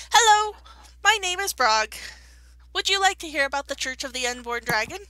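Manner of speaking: eager kobold missionary. Acted. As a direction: open with a big eager hello. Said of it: "and he's like".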